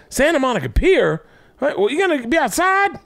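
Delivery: very high-pitched impersonation, incredulous questions; high mocking impression of another comic; Patrice. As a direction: squeal the questions in a put-on comic voice. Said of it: "that's a Patrice impression".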